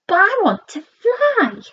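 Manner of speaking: high pleading little frog; high child voice. Acted.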